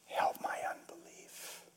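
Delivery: barely audible whispered plea; softly spoken. Retold or imitated. Father whispering his doubt, hushed plea. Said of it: "he softly spoke".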